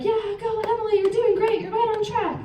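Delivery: high, sing-song imitated coach cheer; cheerful coach. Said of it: "he's like".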